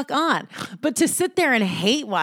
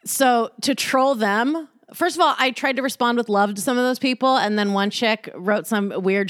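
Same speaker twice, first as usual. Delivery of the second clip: usual speaking voice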